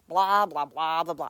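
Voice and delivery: loud, bored blah-blah brush-off; mock dismissive blah. Podcaster pretending to brush off an upset friend, loud and dismissive. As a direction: toss off the blahs like you're not listening.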